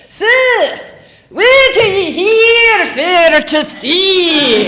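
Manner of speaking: put-on broad Scottish accent. Tourist telling the pub crowd what the bartender asked, hammed-up Scottish brogue. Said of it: "What he actually said was".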